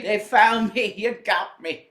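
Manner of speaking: gleeful hammed-up southern twang; southern accent. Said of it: "putting a southern accent on him".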